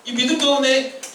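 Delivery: high, mock-disapproving voice; God. Preacher voicing God eyeing Israelites' grumbling, hammed-up disapproval. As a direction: act out a displeased discovery of grumblers. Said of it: "그 백성들을 하나님이".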